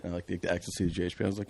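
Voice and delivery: normal talking voice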